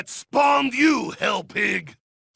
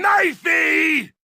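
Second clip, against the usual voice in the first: "knifey" shouted higher and louder, falling; 6 semitones higher, 3 dB louder than usual. Acted. Russian soldier peaks his knife chant, shouting "knifey!" high. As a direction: yell "knifey!" up high and loud with a falling end.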